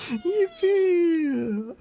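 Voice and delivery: high squealing yippee; excited cheer. Real, not acted. Streamer cheers for a new follow, squealing high. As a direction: shriek it with glee.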